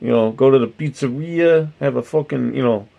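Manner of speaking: put-on East Coast accent, pitched slightly up; drifting mock accent. Streamer planning a pizza trip, drifting mock East Coast accent. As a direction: slip into a muddled Jersey pizzeria accent. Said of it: "see how it starts to evolve".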